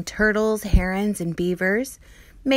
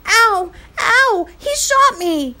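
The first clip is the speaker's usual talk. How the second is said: much higher and louder cries of pain; pained scream; 12 semitones higher, 7 dB louder, slower than usual. Acted. Patrick shot in the cheek, screaming in pain. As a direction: yelp like you've just been shot. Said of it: "Patrick screamed".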